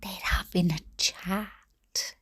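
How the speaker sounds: slow whispered aside; imitating dad's whisper to his friend; man-code whisper. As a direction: hush it like a secret between men. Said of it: "it's probably gone like".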